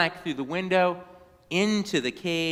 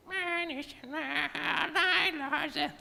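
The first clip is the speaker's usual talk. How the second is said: very high, soft squeaky parrot voice; 11 semitones higher, 8 dB quieter than usual. A narrator's reading. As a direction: squawk it back like a parrot. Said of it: "repeated in his parrot squeaky voice".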